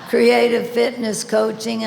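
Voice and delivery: "creative fitness coaching" pushed out between real laughs; giggling correction of "creative fitness coaching"; laughing through it. Real, not acted. Rereads phrase through a laugh.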